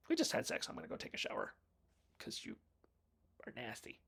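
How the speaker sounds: unvoiced teasing whisper; hushed mock insult to her lover. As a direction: breathe it out as a sassy jab. Mock nasty line, whispered.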